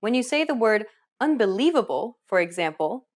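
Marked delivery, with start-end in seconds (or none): 1.2-2.2 "unbelievable" with a long, strong LI; stress on LI. Shown as a demonstration. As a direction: draw out and accent the third syllable of "unbelievable". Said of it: "notice how the li is accentuated".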